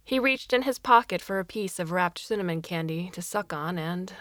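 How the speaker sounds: ordinary delivery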